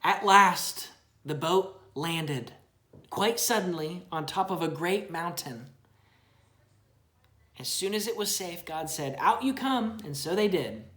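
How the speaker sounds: storybook narration for a little one; reading to a child. Acted. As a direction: read it gently to your child. Parent reads Bible story aloud.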